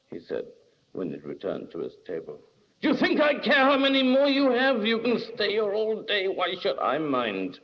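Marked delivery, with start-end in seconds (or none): 2.8-7.6 high, heated outburst easing off; mounting temper. A narrator's reading. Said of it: "as his temper mounted".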